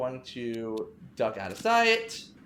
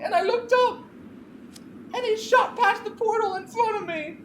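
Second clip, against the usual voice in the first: much higher, louder horrified outburst; Clarissa frantic; 6 dB louder than usual. Acted. Clarissa tells Veronica she saw Malcolm fall, frantic. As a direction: pour out what you saw in a panic.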